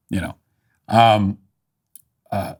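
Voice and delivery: ordinary talk, nothing marked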